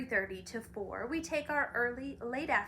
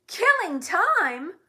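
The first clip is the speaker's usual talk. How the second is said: slow, much louder, higher outraged roar; furious roar; 9 semitones higher, 12 dB louder, much slower than usual. Acted. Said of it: "roared the dog so furiously".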